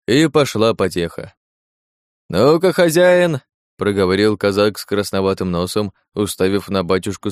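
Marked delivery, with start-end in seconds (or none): 2.3-3.4 high, drawn-out challenge; goading. Acted. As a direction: egg the host on, high and slow. Казак подзадоривает хозяина плясать. (протяжно подзадоривает, высоким голосом)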